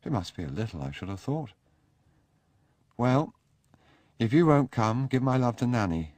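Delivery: a careless wave goodbye in the voice; Sebastian taking leave. Acted.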